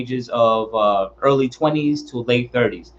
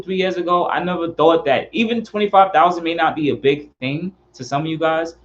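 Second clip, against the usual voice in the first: ordinary talk, nothing marked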